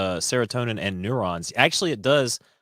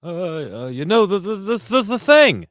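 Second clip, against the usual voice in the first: high, rising, louder stammering; flustered stammer; 10 semitones higher, 7 dB louder than usual. Pretend. Cohost mocking Biden stammering before the Queen. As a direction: fumble for words like a confused old man.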